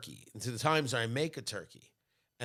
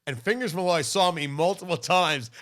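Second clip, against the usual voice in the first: ordinary talk, nothing marked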